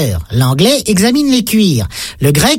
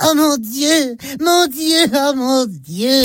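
high, drawn-out mock ecstasy; imitated moaning cries; 4 semitones higher, 3 dB quieter, much slower than usual.

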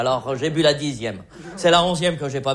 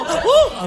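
high, louder hoot of glee; mock delighted hoot; 13 semitones higher, 4 dB louder than usual. Drunk man waving at aliens, high gleeful hoot.

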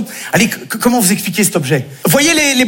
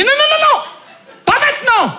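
much higher, squeaky panicked non non non; panicked refusal. Acted. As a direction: shriek it out in a hammed-up panic.

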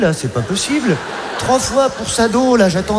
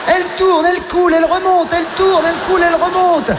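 excited; 8 semitones higher, faster than usual. Acted. Stand-up comic narrating the turd in a rising frenzy. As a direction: call it like a frantic sports commentator.

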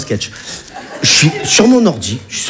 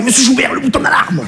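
much higher, urgent hostage whisper-cry; panicked banker; 8 semitones higher than usual. Retold or imitated. Banker begs the comic during a hold-up, much higher and urgent. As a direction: hiss it up high like a panicking banker. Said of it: "il m'a fait".

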